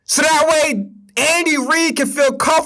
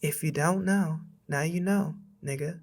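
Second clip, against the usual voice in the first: a light put-on Kamala impression, much softer; lightly voiced Kamala parody, much softer; mock Kamala voice; 7 semitones lower, 13 dB quieter than usual. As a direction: hush it down into a mock Kamala line. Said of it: "we gotta do it in a Kamala voice".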